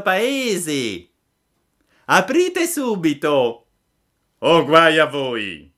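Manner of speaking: high, loud, drawn-out shouted order; threatening, full-voiced call through a door; commanding shout. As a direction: demand entry as if from outside the door. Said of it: "una voce di fuori disse".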